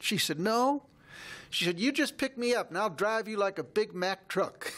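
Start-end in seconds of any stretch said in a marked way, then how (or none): 0.4-0.8 put-on high, louder child's protest; a girl's firm no, pitched way up; little girl voice. Quoting the girl Molly. 1.8-4.7 put-on high child's voice, eager; little girl voice. Quoting the girl Molly.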